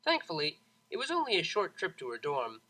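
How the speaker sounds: ordinary delivery, nothing marked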